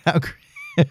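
high, cracking up mid-word; can't finish the word for laughing; laughing helplessly. Real, not acted. Vlogger laughing at his own speed dating idea, pitched high.

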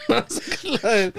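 high, slow words dissolving into giggles; laugh-choked and high; still laughing. Real, not acted. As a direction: say it through leftover laughter.

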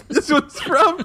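laughing through the words; can't stop giggling. Real, not acted. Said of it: "Why is this so funny to you already?".

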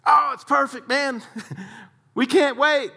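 high-pitched, giddy golfer voice; excited golfers. Retold or imitated.

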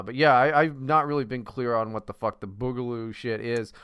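usual speaking voice

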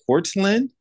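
high, rising, puzzled Cortland?; mock supervisor asking a question; supervisor's mispronunciation. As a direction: imitate the supervisor getting the name wrong. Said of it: "She was like".

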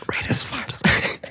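slow, hushed whisper. Real, not acted. Podcaster asking listeners for ratings, slow whisper. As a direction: whisper a plea, drawing it out. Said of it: "I'm gonna whisper the rest".

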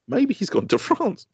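put-on high, slow little-boy voice; child's hopeful voice. Father imitates son's hopeful guess, high slow child voice. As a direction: say it like a hopeful kid.

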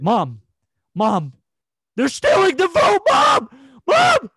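panicked yelling for mom; screaming to mom. Retold or imitated. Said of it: "I was screaming".

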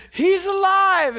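high, slow proclamation of good news; joyful announcement. Retold or imitated. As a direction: call it out like glad tidings. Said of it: "There's a lot of people saying".

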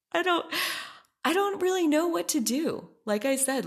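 high, loud helpless laughing words; real laughing despair, high and loud. Real, not acted. Laughing helplessly about the harasser.

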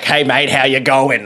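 proper Australian matey hello; broad Australian accent. Acted. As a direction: greet them in proper Aussie voice. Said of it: "I have a very Australian voice".

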